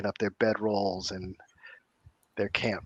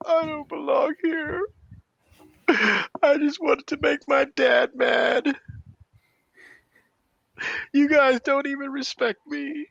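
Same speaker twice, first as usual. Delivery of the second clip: sobbing outburst. Acted. Tish breaks down before the crowd, sobbing outburst. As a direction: wail it out through sobs. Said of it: "her body just racks with sobs".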